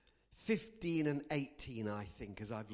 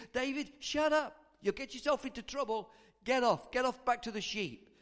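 raised-voice brotherly telling-off; angry; 6 semitones higher, 4 dB louder than usual. Acted. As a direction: order him away, high and loud. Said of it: "And he gets angry".

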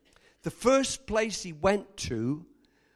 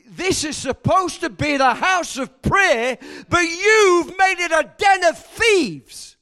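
furious shout; 7 semitones higher, 10 dB louder than usual. Acted. Jesus shouting at the traders in the temple, furious. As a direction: thunder at them as if clearing the temple.

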